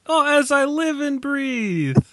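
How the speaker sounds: put-on high, slow old-movie lady; hammed-up old woman, pitched high and drawn out; old lady impression. Old lady spotting the gangster, hammed-up old-movie voice. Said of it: "Her first line was like".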